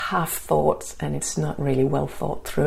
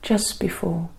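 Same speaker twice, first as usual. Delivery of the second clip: guided meditation. Acted. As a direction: ease them into calm, gently.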